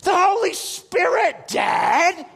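loud, high, drawn-out shout; exclaiming child. Retold or imitated. Son explaining it to dad, slow loud high shout. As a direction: stretch it out like an exasperated kid.

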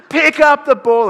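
huge drawn-out sideline yell, high and very loud; imitating a dad bellowing from the sideline; dad screaming. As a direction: scream it across the field at the top of your lungs. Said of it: "screaming at the top of his lungs".

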